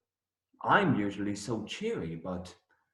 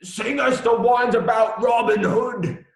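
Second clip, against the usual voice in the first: begging serfs; 12 semitones higher, 7 dB louder than usual. Retold or imitated. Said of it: "serfs beg me".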